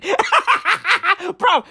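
high laugh into bruv; cracking up. Real, not acted. Laughing mid-rant.

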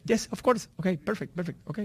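a hotel porter answering in Indian English; Indian accent. Retold or imitated. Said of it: "Ellos hablan el inglés con acento hindi". (el botones responde con acento indio de imitación)

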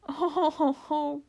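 high, acted sobbing moan. A narrator's reading. Fawn answering Lucy's scolding, acted sobbing. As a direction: wail it in a put-on sob. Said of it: "sobbed Mister Tumnus".

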